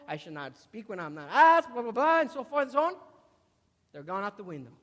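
usual speaking voice

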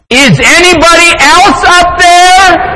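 desperate yell. Acted. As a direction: yell for someone, anyone else. Man calls up again in desperation.

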